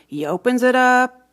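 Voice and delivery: ordinary delivery, nothing marked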